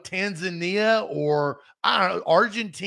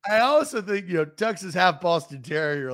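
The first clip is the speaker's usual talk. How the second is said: ordinary talk, nothing marked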